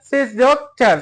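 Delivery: "সিস যোগ চার" in two parts, joined by যোগ; split into syllables. Shown as a demonstration. Teacher showing the two parts of সিস্টার, split into syllables. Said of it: "এই শব্দ তার দুইটা অংশ আছে".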